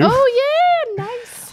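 high, loud "oh yeah" cheer; excited approval. Real, not acted. Co-host cheers the episode pick, excited. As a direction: cheer the pick, pitch shooting up.

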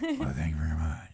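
reply as the star in a mock deep voice; visiting film star.

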